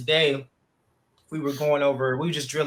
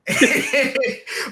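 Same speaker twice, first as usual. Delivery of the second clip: sudden high laugh bursting out; high burst of laughter; 11 semitones higher, 6 dB louder than usual. Real, not acted. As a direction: break into a high delighted laugh. Laughs at daughter's pout.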